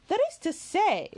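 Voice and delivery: high, scornful cut-in; contemptuous. A narrator's reading. Marianne scoffing at Elinor, high and contemptuous. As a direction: sneer at her sister's defence of the colonel. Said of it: "cried Marianne contemptuously".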